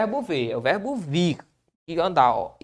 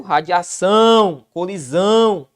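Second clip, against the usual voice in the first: radiação, colisão said slowly and louder, leaning on -ção and -são; drawn-out stress on the last syllables; 3 dB louder, slower than usual. Shown as a demonstration. Teacher showing students the oxytone stress, slow and louder. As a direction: stretch radiação and colisão to show where the stress falls.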